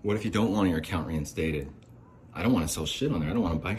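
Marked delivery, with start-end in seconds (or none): none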